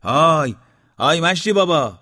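hands cupped, shout up to the roof. Acted. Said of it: "داد زد".

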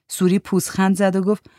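ordinary delivery, nothing marked

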